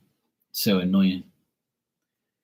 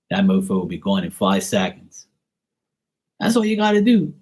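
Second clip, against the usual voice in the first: ordinary talk, nothing marked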